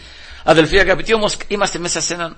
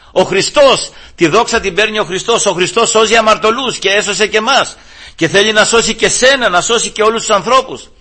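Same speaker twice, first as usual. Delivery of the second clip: louder, higher, with rising fervour; fervent preaching; 7 semitones higher, 5 dB louder than usual. Acted. Preacher to congregation, loud fervent preaching. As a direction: drive the point home to the congregation.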